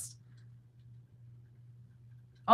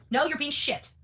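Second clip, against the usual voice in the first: normal talking voice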